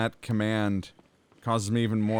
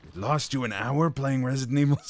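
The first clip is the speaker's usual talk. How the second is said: lightly voiced creepy mind reader; a touch of a taunting psychic villain; Psycho Mantis voice; 2 semitones higher than usual. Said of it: "Psycho Mantis voice".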